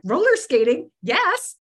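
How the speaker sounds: high, eager excitement; delighted. Retold or imitated. Newcomer invited roller skating by new friends, delighted. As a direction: jump at the invitation.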